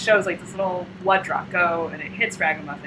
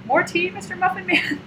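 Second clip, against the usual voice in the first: put-on very high-pitched child voice; sing-song hostess pitched way up; sweet little girl; 12 semitones higher than usual. Girl serving her doll at tea party, high and cutesy.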